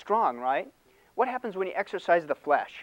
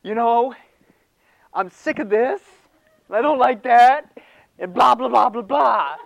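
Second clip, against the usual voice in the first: higher and louder, hammed-up griping at an empty chair; mock venting rant; 8 semitones higher, 11 dB louder than usual. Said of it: "it's empty chair therapy".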